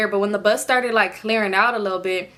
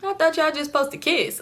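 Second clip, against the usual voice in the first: incredulous; 8 semitones higher than usual. Retold or imitated. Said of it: "I'm like".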